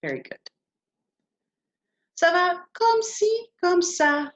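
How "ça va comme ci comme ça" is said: slow, much higher, drawn-out demo; slow model phrase. Shown as a demonstration. Teacher modelling the phrase slowly for students, much higher. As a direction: spell it out slowly and high for the learners. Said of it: "Can you say that?".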